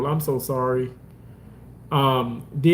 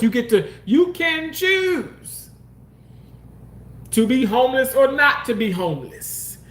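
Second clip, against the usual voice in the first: mock preachy; 5 semitones higher than usual.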